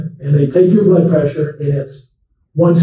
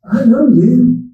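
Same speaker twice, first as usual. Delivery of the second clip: put-on higher and slow, an old man's friendly recognition; slow old patient; 6 semitones higher, slower than usual. Old patient greeting his doctor, slow and higher. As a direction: draw out each word like a confused old man.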